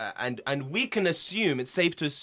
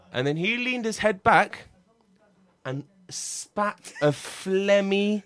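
ordinary talk

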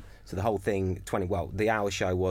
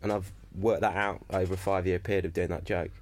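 usual speaking voice

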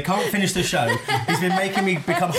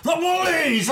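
mock yell; 12 semitones higher, much slower than usual. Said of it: "chef repeating".

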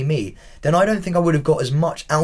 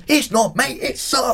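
instructor's rebuke; 9 semitones higher than usual. Retold or imitated. Instructor corrects him sharply.

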